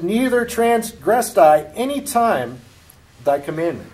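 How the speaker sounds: high and loud, slow, pleading his record; aggrieved son. Acted. Elder son protesting to his father, loud and high. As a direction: stretch out his complaint, wounded pride.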